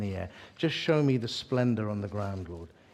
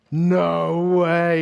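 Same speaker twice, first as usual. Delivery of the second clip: loud, higher, stretched-out amazement; acted disbelief; 4 semitones higher, 7 dB louder than usual. Couple spotting the preacher on the return flight, loudly amazed. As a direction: draw it out in mock astonishment. Couple astonished to see him again.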